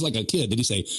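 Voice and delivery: ordinary talk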